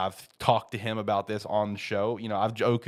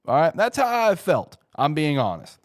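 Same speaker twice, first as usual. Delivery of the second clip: emphatic; 6 semitones higher, 6 dB louder than usual. Real, not acted. Emphatic Packers confession.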